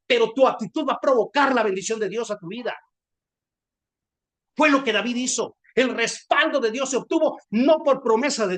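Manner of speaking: loud, driving sermon climax; full-force preaching. Real, not acted. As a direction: proclaim it loudly to the congregation. (predicando con toda la fuerza)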